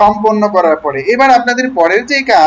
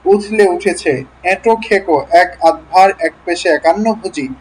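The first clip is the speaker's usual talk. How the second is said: word-list drill, one word at a time; deliberate, spaced-out articulation; slow reading. Shown as a demonstration. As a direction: read each word slowly and separately, fully articulated. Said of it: "আমি ধীরে ধীরে পড়লাম".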